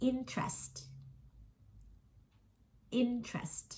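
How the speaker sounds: INtrest, high first syllable, light second; stress on first syllable. Shown as a demonstration. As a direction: say "interest" with a high IN and a soft falling -trest. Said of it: "stress อยู่ที่พยางค์ที่". (เน้นพยางค์แรกของ interest สูง แล้วเบาลงท้ายคำ)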